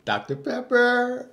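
high, slow, laughing echo of the name; laughing "Doctor Pepper?"; incredulous echo. Acted. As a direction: repeat it in amused disbelief. Mock repeating the name, laughing.

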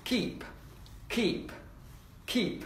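"Keep" as short separate model words; a bit quick. Shown as a demonstration. Teacher models keep for repeating, quick and short. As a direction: say each "keep" quickly, pausing between. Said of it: "đọc nhanh một chút".

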